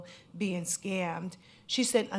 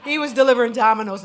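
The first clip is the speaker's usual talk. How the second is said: fast, much louder and higher burst; mock outrage; 5 semitones higher, 15 dB louder, much faster than usual. Real, not acted. Scammer sent Domino's to a pizza lover, loud mock outrage. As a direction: blurt it out in playful disbelief.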